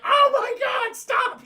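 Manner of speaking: panicked villain squeal. Retold or imitated. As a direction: shriek it high and loud in panic. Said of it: "squealing his ass off".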